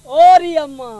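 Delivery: loud call to mother; child calling amma. Acted. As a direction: call out to mother across the house.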